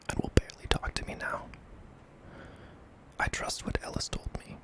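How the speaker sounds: plain reading voice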